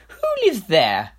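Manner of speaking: high, slow kingly question; king's voice. Acted. King asking his adviser, high and slow. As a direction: ask like a curious, pompous king.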